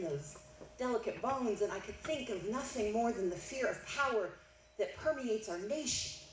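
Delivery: usual speaking voice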